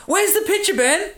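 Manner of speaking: mock demand. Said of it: "we're looking at a picture right now".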